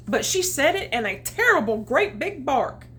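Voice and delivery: ordinary delivery, nothing marked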